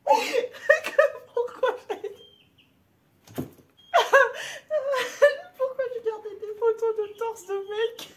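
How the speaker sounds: high, wheezing laugh through the words; laughing so hard it hurts; crying with laughter. Real, not acted. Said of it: "j'en pleure de rire".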